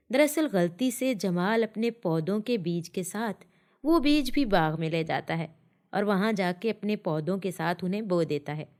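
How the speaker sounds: ordinary delivery, nothing marked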